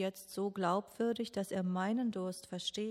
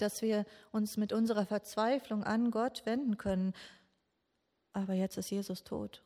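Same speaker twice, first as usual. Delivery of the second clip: usual speaking voice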